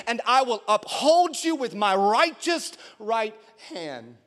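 high, emphatic proclamation with stretched key words; full-force scripture preaching. Real, not acted. Preacher declaring God's promises to the church, high and impassioned. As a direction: declaim each command with force and ringing weight.

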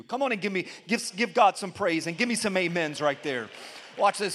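ordinary delivery

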